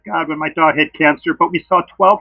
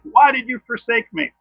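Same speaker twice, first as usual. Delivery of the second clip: mildly indignant, higher-pitched mock protest; Jesus, ticked off; 7 semitones higher than usual. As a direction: act out an aggrieved plea, raised pitch but no shouting. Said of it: "wouldn't you say Jesus was ticked off?".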